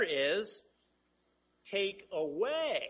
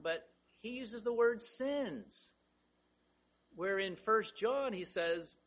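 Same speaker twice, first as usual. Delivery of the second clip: normal talking voice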